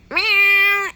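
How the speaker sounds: high, loud imitated meow; cat meow. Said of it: "I couldn't find a cat".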